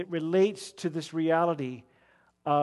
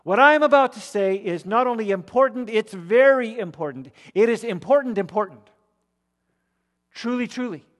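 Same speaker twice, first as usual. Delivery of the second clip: louder and higher, pressing the listeners to hear; urgent call to attention; 6 semitones higher, 9 dB louder than usual. Retold or imitated. Preacher voicing Jesus calling the crowd to listen, urgent and loud. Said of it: "he's calling particular attention".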